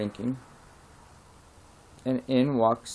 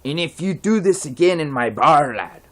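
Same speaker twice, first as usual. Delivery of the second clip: a slightly raised, louder Irish-tinged warning; threatening bartender; 4 semitones higher, 5 dB louder than usual. Acted. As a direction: lean in and threaten the troublemaker. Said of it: "And he says".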